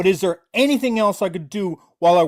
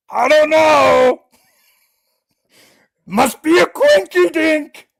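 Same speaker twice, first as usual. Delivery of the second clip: slow, much higher and louder, fake-innocent sing-song; sarcastic mock innocence; 8 semitones higher, 5 dB louder, slower than usual. Streamer mocking the caught woman, sarcastic fake innocence. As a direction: play dumb to mock her. Mocking Raina's denials on live.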